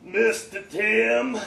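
put-on old bandleader calling out, raspy; Charles's raspy voice. A narrator's reading. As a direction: stretch out the name, raspy and loud. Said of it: "in that legendary raspy voice".

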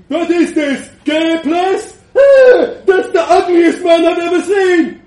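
loud, high, brash shouting; a wild young man mocking the room at full volume; rowdy yelling. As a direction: bellow it at the room like a drunk. John dances around and yells.

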